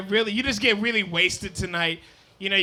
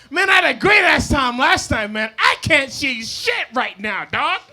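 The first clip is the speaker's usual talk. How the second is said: hammed-up frantic drinker; 3 semitones higher, 4 dB louder than usual. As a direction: start high and loud, then stretch it out. Acts moonshine drinker gone blind.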